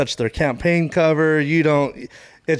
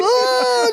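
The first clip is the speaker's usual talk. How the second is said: laughing mock groan; 3 dB louder than usual. Retold agony amid laughter.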